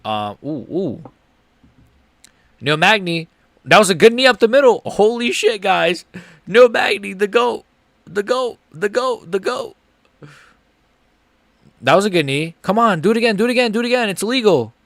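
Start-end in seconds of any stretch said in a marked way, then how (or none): 3.6-14.1 high, loud hype; excited cheering. Real, not acted. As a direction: cheer your fighter on. Cheering on his fighter.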